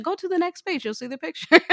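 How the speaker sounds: high, sing-song deflection; evasive mom. Retold or imitated. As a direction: dodge it high and breezy. Mother dodges explaining, points to picture.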